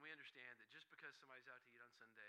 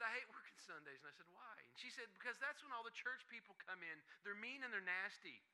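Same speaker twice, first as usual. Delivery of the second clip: annoyed clerk; 6 semitones higher, 5 dB louder than usual. Retold or imitated. Clerk complains about church people.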